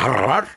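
short, high, loud "arr"; imitated growl. Storyteller growling back at the dog, loud mock growl. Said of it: "I was like".

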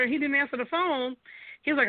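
usual speaking voice

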